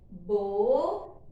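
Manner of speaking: loud, stretched Boca; sounding out. Shown as a demonstration. Teacher reading aloud with the child, loud and stretched. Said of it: "Vamos ler?".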